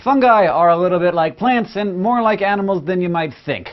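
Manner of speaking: ordinary talk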